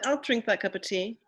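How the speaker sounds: usual speaking voice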